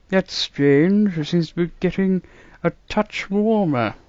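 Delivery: put-on musing old man, baffled; puzzled grandfather voice; old doctor voice. A narrator's reading.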